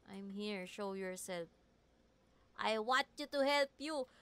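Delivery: normal talking voice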